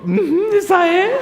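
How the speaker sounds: "Ujú, esa es" agudo y fuerte entre risas; confírmalo soltando la carcajada; riendo. Real, not acted. Riéndose al confirmarle al hijo. (al hijo, riéndose: sí, esa es)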